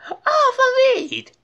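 high, loud scolding yell. Acted. D W calling Arthur back inside, yelling. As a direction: call him in sharply. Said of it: "D W yelled".

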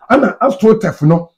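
normal talking voice

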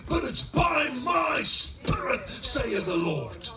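loud, high-pitched revival preacher; rousing pulpit shout; full-force preaching. Real, not acted. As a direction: shout it to the whole congregation, driving home the key words.

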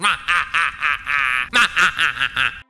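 hammed-up villain cackle; mock evil chuckle; evil laugh. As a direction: gloat like a cartoon villain. Revenge as Piggy.

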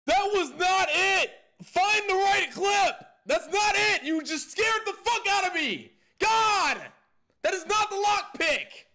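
high furious yelling after fright; angry shouting. Real, not acted. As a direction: scream then rage at it. Scared then yelling at clip.

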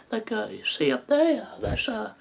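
put-on high, thin old-man voice; Herbert voice. Shown as a demonstration. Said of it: "I could do his voice".

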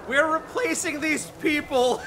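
slow, high, laughing through it. Real, not acted. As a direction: say it cracking up. Joking as the robot.

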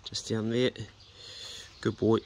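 ordinary talk, nothing marked

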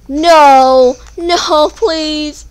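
loud, high desperate begging; yelling no at the enemy, pleading; pleading shout. Real, not acted. Gamer begging the attacker loudly to stop.

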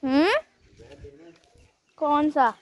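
loud, high questioning call; calling back. Real, not acted. Vlogger calls back to his uncle, loud and high. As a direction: shout the question back across the distance.

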